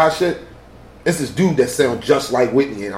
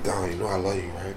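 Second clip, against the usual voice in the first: soft mock affection; 8 semitones lower, 13 dB quieter than usual. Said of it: "She's like".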